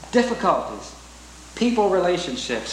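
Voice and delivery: normal talking voice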